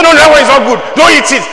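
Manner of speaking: high, loud stern daddy forbidding; stern father. Retold or imitated. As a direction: scold the kids, don't eat it. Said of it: "Daddy will say".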